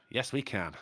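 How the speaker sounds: lightly voiced Obama, low and soft; a hint of a presidential slogan, deep and soft; soft mock Obama slogan; Obama impression. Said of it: "Obama came in and went".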